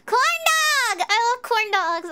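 high, loud burst of joy over corn dogs; real excitement, loud and high; overexcited. Real, not acted. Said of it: "I got too excited".

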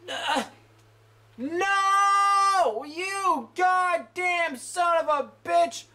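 real frustrated gamer outburst; angry shouting. Real, not acted. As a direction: scream at the screen in real rage. Player raging at game death.

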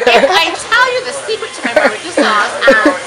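joking I'll have to kill you; playful mock threat. Playful secret sauce threat.

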